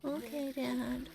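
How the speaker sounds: soft, slow, defeated okay; sulky kid giving in. Retold or imitated. Toddler finally agreeing to the bath, soft and sulky. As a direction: give in with a sulky little sigh. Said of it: "He's like".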